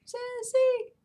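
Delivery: high sing-song call to the cat. Real, not acted. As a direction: call her name up high across the room. Calling her cat.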